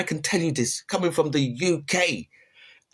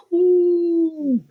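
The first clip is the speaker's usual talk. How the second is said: high, loud, drawn-out ooh; surprised ooh; 7 dB louder than usual. Real, not acted. Vlogger seeing sixty percent, high and loud surprise. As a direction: react with shock at the number. Reacting to a statistic.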